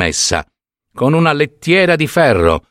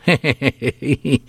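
mocking laugh; 3 semitones higher, 4 dB quieter than usual. A narrator's reading. Younger woman laughs at the old woman's grief, mocking laugh. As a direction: let out a short teasing giggle.

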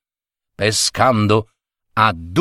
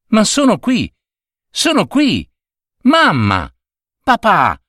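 high-pitched calls, only mildly raised; pleading cry; 7 semitones higher than usual. A narrator's reading. Invisible boy to his parents, pleading cry. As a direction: try to get unseeing parents' attention. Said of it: "gridava Tonino".

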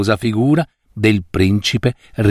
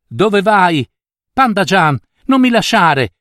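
higher, anguished cry; mother calling after her vanishing daughter, higher and anguished; desperate pleading; 7 semitones higher, 3 dB louder than usual. A narrator's reading. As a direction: beg her daughter not to go. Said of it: "La madre supplicò".

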